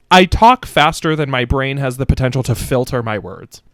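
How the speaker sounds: ordinary talk, nothing marked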